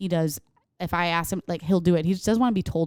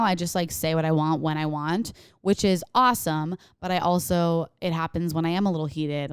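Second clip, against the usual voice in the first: ordinary talk, nothing marked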